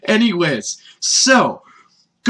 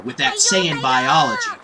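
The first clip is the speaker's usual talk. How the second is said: normal talking voice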